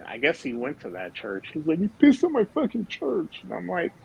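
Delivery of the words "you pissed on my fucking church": outraged accusation. Retold or imitated. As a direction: take it as a personal insult.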